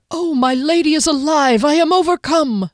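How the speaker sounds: high, loud theatrical gush; halfling gushes over finding his lady; hammed-up intoning. A narrator's reading. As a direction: overact the halfling's relief. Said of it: "Skimnoddle intoned".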